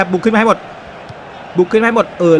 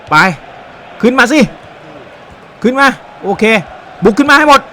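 urging shout; 6 semitones higher than usual. Real, not acted. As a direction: yell the team forward, building. Urging his team forward.

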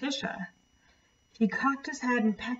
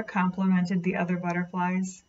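plain reading voice

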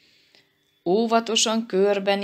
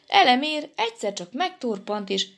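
ordinary delivery, nothing marked

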